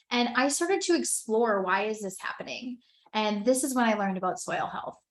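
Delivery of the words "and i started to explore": normal talking voice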